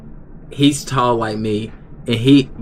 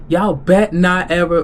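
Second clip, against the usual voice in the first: mock angry warning; 7 semitones higher, 4 dB louder than usual. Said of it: "he was mad, he was heated".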